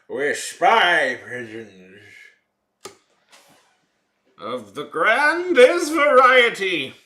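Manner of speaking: slow, booming, high showman voice; grand announcer voice. Acted. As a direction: proclaim it like a ringmaster.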